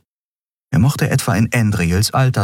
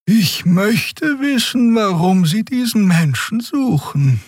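much higher, slow musing; wondering aloud; slower than usual. A narrator's reading. Xea musing to Keru on the empty street, slow higher voice. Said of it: "überlegte Xea laut".

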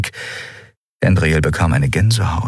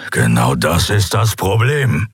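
slow growling voice; dark, reluctant growl. A narrator's reading. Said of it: "Sein Knurren war düster". (düsteres, widerwilliges Knurren)

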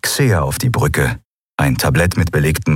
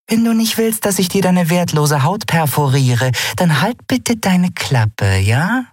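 high, measured warning ending sweetly pointed; patient threat; 12 semitones higher than usual. Acted. Nelen tells Seth to shut up, patient threat. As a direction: threaten him patiently, pitched up. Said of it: "sagte Nelen geduldig".

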